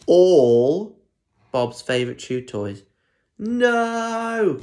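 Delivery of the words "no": high, loud anguished no; cried. Acted. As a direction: wail it like a dog. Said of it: "cried Bob".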